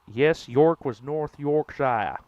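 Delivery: slow, loud British-style read of the York line; mock British accent. Shown as a demonstration. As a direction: say the whole line like a Brit. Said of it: "Say it all in one".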